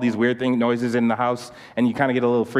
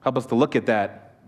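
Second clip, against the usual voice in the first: closing prayer; 2 semitones lower than usual. Real, not acted. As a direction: pray gently on the group's behalf, leaving space between phrases.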